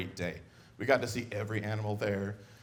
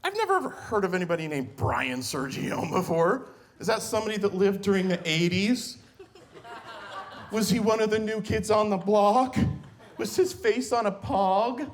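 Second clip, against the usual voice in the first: higher, whiny frustrated daughter voice; cocky daughter; 9 semitones higher than usual. Retold or imitated. Frustrated daughter losing at cards, whiny mock voice. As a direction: complain like a bratty teen. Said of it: "She's like".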